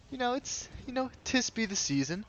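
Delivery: light mock-wistful high voice; musing. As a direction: float it up high like a whimsical thought. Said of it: "I was like".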